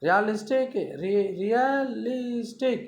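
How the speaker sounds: teacher counting the syllables of realistic. Shown as a demonstration. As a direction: spell out re-al-is-tic slowly. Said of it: "four syllable".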